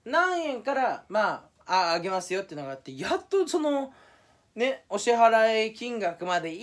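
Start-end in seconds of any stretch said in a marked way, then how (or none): none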